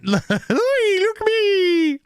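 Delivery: high, slow, camp preening voice; hammed-up show-off drawling ooh; mock preening villain. Mocking the Joker's preening.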